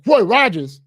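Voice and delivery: loud, high exclamation of delight; excited recognition. Retold or imitated. Driver spotting a favorite restaurant, excited. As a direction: burst out at a happy sighting.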